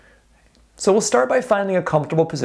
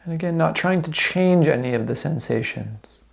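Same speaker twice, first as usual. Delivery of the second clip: calm, unhurried meditation guide with long pauses; guided meditation. Real, not acted. Instructor guiding listeners through a body scan, calm guided meditation. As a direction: ease them gently through a body scan, leaving space between phrases.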